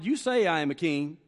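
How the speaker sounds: ordinary talk, nothing marked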